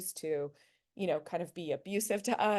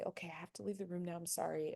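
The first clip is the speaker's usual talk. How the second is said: softer, quick apologetic exit; 9 dB quieter, faster than usual. Shown as a demonstration. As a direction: calmly excuse yourself and leave. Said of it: "with a really soft voice".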